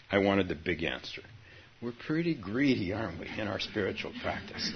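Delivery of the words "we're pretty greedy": usual speaking voice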